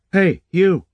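high, loud call after someone walking away. A narrator's reading. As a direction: shout to make him turn round. Calling after him as he leaves.

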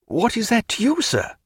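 high, sharp challenge, falling away; sharp retort. A narrator's reading. Stephen turning upon Canning, sharply. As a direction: turn on him with a pointed question. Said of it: "turning sharp upon him".

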